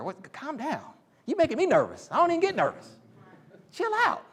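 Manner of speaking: hammed-up "calm down" to a frantic friend; mock alarmed friend. Acted exchange with busy worker.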